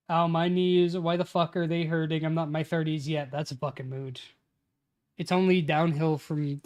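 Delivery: ordinary talk, nothing marked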